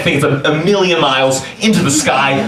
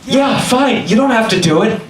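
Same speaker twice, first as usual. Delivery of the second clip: higher-pitched, dismissive okay; easy concession; 7 semitones higher than usual. Retold or imitated. Friend letting him off the jump, higher and dismissive. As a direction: give in as the friend, lightly imitated.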